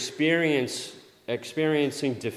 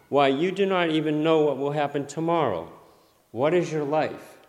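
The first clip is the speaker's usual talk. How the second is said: scripture reading; 4 semitones higher, 5 dB louder than usual. Shown as a demonstration. Reading James scripture aloud.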